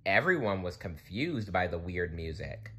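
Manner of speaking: ordinary delivery, nothing marked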